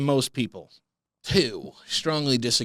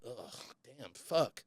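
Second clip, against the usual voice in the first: normal talking voice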